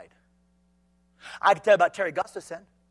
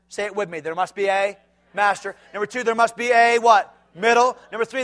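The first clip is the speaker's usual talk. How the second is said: ordinary delivery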